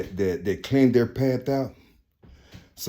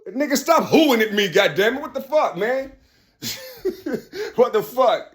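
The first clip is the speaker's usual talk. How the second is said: high loud exasperated voice; mock annoyed God; 12 semitones higher, 3 dB louder than usual. God fed up with Lucifer's hooing, high and loud. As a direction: snap back, fed up.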